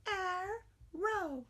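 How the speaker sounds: put-on high childlike arrow; student voice. Shown as a demonstration. Teacher plays the student, a high childlike arrow. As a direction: answer arrow like a young pupil. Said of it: "You say it".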